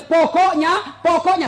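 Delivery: high, loud insistent wife voice; mimicking stubborn wife. Husband mimics wife insisting she is right, high and pushy. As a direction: pitch up and insist like a nagging wife. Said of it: "Apalagi kalau dia bilang".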